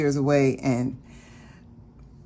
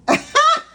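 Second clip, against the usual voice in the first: high delighted laugh; 7 dB louder than usual. Real, not acted. Laughing at her lucky rent deal.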